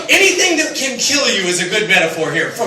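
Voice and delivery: usual speaking voice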